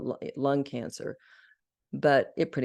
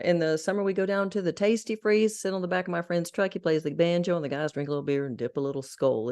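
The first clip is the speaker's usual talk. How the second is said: put-on Southern accent; 3 semitones higher, faster than usual. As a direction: keep the Southern accent rolling quickly. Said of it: "I was in a Southern accent".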